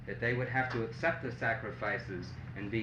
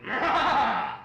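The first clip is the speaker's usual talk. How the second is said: loud high roar; lion's growl; 12 semitones higher, 10 dB louder than usual. Acted. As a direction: roar out like a lion. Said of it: "But the lion just growled".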